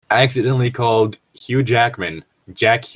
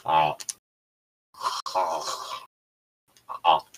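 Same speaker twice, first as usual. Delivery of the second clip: gagging on a throat swab; choking ahs with swab in throat; 8 semitones lower, 9 dB quieter, much slower than usual. Real, not acted. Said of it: "You sound like you're gagging on something".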